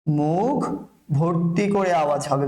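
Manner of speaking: slow, drawn-out teaching phrase; slow dictation. Shown as a demonstration. Teacher drilling students on a pronunciation rule, slow dictation. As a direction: stretch each word for learners to follow.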